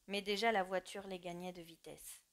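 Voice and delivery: usual speaking voice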